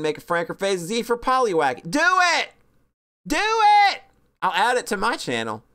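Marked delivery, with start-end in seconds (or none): 1.9-4.0 loud, high eager cheering; excited cheer. Real, not acted. Streamer cheering on a chat member, excited. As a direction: egg them on, loud and bright. Urging chat to make emote.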